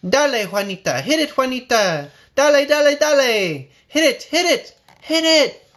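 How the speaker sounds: high, loud chant building to a yell; cheering crowd. Acted. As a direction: egg the blindfolded girl on. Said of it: "they cried".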